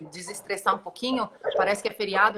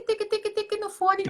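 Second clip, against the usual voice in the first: clicking sound imitation; 10 semitones higher than usual. Imitates earring clicking on headphone.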